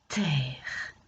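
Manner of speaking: "terre" whispered with no final vowel; mute E whispered. Shown as a demonstration. Teacher showing "terre" to a pupil, whispered. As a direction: breathe "terre" softly, the E silent. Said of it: "Est-ce que je dis la".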